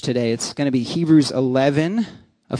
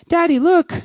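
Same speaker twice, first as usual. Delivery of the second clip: high-pitched imitation of a small daughter; little child's voice; 14 semitones higher, 4 dB louder than usual. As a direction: mimic a little kid calling Daddy. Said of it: "I hear this little voice next to me".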